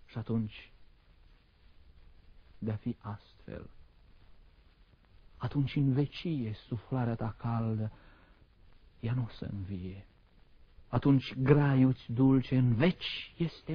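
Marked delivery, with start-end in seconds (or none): 0.1-10.1 soft, halting lines with long pauses; hushed grief. Acted. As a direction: let it sink into quiet resignation. Poet faces death as final.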